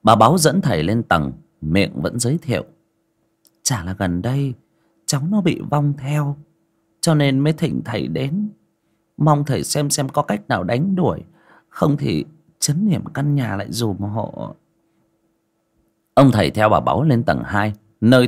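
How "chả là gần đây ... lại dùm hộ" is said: put-on cheerful old lady. A narrator's reading. Bà Báu giới thiệu thầy.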